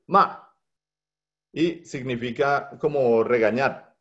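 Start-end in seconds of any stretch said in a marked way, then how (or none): 0.1-0.6 short, high MA dropping sharply; falling tone. Shown as a demonstration. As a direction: start MA high and drop it quickly. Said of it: "es fuerte y rápido".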